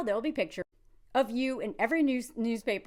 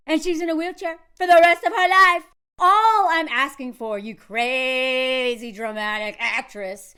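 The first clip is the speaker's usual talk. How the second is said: mock lecturing; 8 dB louder than usual. Mocking his lecture to her.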